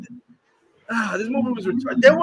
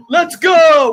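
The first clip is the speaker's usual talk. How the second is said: loud, higher mocking "Let's go!"; mock shout; 6 semitones higher, 8 dB louder than usual. Mocking an actor's modern battle cry with a loud shout. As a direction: bark "Let's go!" like a dumb movie soldier.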